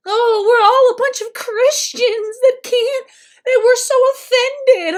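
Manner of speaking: loud, high-pitched sarcastic wail; hammed-up indignant whine; mock outrage. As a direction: mimic people being hugely offended. Mocks offended Christians after a comment.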